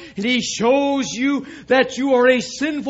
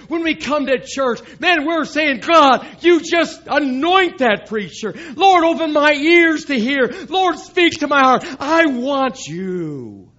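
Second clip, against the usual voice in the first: preaching at full force, raised and loud; fervent shout; 6 semitones higher, 6 dB louder than usual. Acted. Preacher rallies the church, voicing its prayer in a fervent shout.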